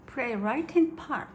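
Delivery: ordinary delivery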